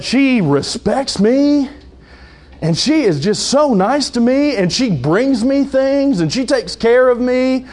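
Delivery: high, slow, dreamy gushing; hammed-up lovestruck self-justifying; mock smitten husband. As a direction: gush slowly like a man infatuated. Acting a smitten husband in counseling.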